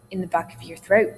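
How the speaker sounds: usual speaking voice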